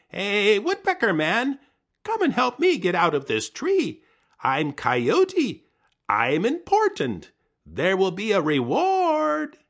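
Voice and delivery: high, loud calling voice; loud high boasting call; Coyote calling out. Acted. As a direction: holler to the woodpecker with self-importance. Coyote calls to woodpecker.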